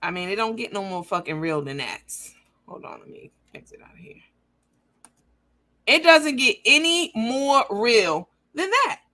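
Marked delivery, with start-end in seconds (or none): 2.7-4.2 hushed aside under the breath; whisper. Real, not acted. Whispering to herself while closing the page. 5.8-9.0 higher, louder and slow, savoring it; gloating. Real, not acted. Gloating to viewers after showing the receipt.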